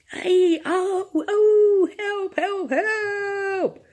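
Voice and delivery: high, loud cries for help breaking into a wail; terrified screams. Acted. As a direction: scream for help as if being eaten alive. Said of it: "a series of most ghastly screams and yells".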